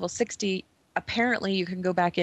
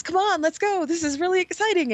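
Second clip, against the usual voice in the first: much higher, excited sidekick voice; eager little buddy; 9 semitones higher than usual. Retold or imitated. Little troll buddy urging the player on, high and eager. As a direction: bounce with mock eagerness. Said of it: "the other guy says".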